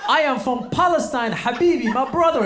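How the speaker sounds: high, loud joyful greeting; joyful brotherly greeting. Retold or imitated. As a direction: embrace a long-lost brother. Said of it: "He goes".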